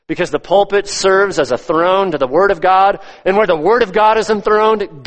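high, insistent pulpit voice; impassioned preaching. Real, not acted. Preacher exalts the Word over the altar, in impassioned preaching. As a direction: preach it with lifted, driving emphasis.

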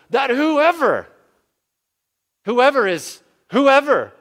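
whoever cried out very high and loud; climactic shout. Acted. Preacher calling out to every listener, ringing shout. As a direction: lift the word whoever to a ringing peak.

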